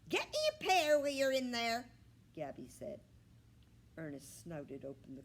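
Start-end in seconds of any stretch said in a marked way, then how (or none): 0.1-1.9 high, loud bossy bird voice. A narrator's reading. Gabby the bird ordering Ernest at the fridge, high loud squawk.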